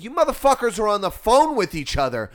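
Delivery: loud, high scolding; exasperated rant. Retold or imitated. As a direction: lay into them in disbelief. Acts out scolding the couple.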